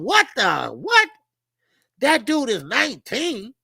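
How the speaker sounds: high, loud astonished "what"; acted disbelief. Fan reacting to a teenage linebacker, astonished. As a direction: cut off, then jump up in shock. Retold amazement at young linebackers' size.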